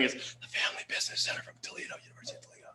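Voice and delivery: breathy secret whisper. Pretend. Secretive aside to audience.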